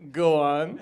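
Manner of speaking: loud, rising 'go on'; hammed-up interest in a bad offer; intrigued reply. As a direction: swoop up as if tempted. Woman's eager punchline reply.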